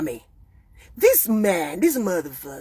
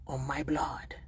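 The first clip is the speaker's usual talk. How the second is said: softer, breathless solemn vow; whispered oath; 6 semitones lower, 8 dB quieter, slower than usual. Real, not acted. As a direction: swear it under the breath.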